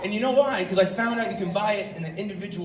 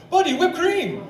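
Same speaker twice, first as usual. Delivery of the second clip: put-on beaming Indian accent, higher pitch; imitating the Indian clerk's accent, cheerful; 10 semitones higher, slower than usual. Indian clerk beaming at customer, Indian accent. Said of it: "that's exactly how he talks".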